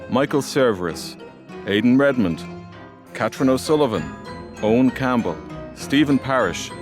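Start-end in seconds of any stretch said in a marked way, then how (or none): none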